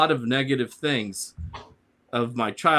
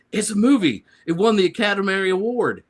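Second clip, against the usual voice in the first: louder, higher, boastful Southern racer; proud Ricky Bobby; 7 semitones higher, 4 dB louder than usual. Retold or imitated. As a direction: brag it like a proud race driver. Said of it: "Ricky Bobby".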